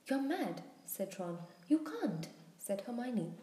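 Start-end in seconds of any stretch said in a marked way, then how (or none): 0.1-0.6 higher, aghast protest. A narrator's reading. Friend aghast at reckless plan. 1.7-2.3 higher, louder objection; alarmed protest. A narrator's reading. Friend protests the dangerous plan.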